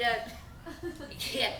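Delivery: ordinary talk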